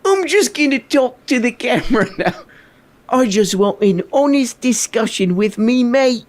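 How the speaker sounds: hammy showman turning to the camera; mock Russell Brand. Reactor impersonating Russell addressing the camera. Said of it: "He's like".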